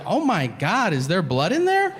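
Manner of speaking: high-pitched mock horror; alarmed wife. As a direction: imitate an aghast wife, voice lifted high. Wife alarmed, asks about blood.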